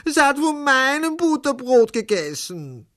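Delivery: put-on very high, loud child's wail; acted tearful high-pitched whine; sobbing little girl. A narrator's reading. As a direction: bawl it like an upset small girl. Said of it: "schluchzte ein kleines Mädchen".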